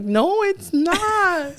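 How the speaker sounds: high drawn-out mock wail; mock crying. Mom protesting her son's girlfriend claim, drawn-out mock wail. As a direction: drag the words out in fake heartbreak. Son repeats girl is girlfriend.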